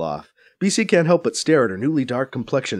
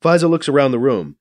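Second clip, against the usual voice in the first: usual speaking voice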